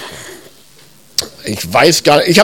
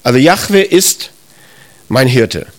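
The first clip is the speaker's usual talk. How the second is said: usual speaking voice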